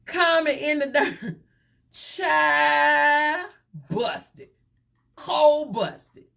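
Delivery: high and triumphant; gleeful busted call. Real, not acted. Host gloating that Common got caught, high gleeful. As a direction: crow it like you caught him. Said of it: "gossip punchline".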